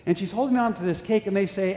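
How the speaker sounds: ordinary talk, nothing marked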